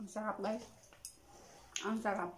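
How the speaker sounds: talking with mouth full; muffled while chewing; chewing food. Real, not acted. Seen 4: cheeks full, lips pursed chewing between words at 0.6-1.1.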